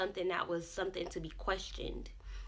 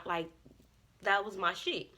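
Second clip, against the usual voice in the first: ordinary talk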